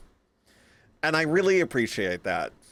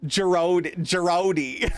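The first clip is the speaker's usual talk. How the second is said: mock name call; 4 semitones higher, slower than usual. Mocking a misspelled name.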